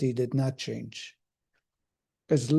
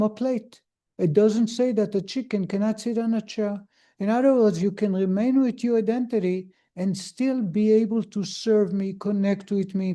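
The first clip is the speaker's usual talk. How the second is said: rising sermon fervor; 6 semitones higher than usual. Acted. Preacher urging his congregation to keep their identity, fervent and raised. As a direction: drive the point home like a pulpit climax. Preacher builds to full force.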